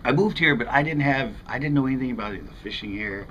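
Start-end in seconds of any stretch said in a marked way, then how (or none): none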